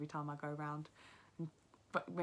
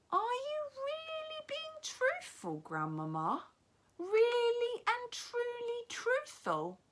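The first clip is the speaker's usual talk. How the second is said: put-on very high, slow child's voice; a wide-eyed boy doubting; young boy; 7 dB louder, much slower than usual. Boy questions grandmother.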